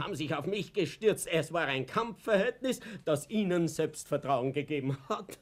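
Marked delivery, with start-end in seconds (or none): none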